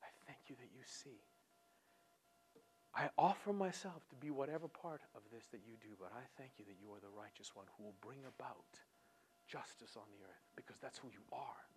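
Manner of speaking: soft, hushed thanks, ending in a whisper; quiet grateful prayer. Retold or imitated. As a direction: pray it quietly, in trust. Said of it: "You say".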